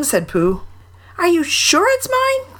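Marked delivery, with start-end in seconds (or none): 1.2-2.6 very high, eager little voice; excited squeak. A narrator's reading. Piglet asks Pooh about his stick, excited squeak. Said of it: "squeaked Piglet excitedly".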